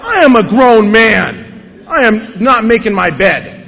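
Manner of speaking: loud, slow, emphatic protest; indignant refusal. Retold or imitated. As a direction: push back hard at being told what to do. Refusing sponsor's order.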